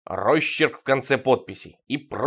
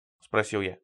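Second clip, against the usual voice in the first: plain reading voice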